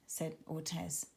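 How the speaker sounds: plain reading voice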